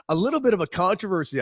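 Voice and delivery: ordinary talk, nothing marked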